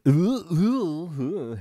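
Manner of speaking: a real laugh, voice cracking upward; a laugh where the voice breaks. Real, not acted. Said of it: "hey voice".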